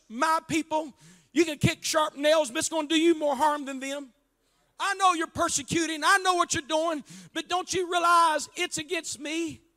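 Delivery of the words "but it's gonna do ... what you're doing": ordinary delivery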